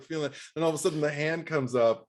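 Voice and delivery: usual speaking voice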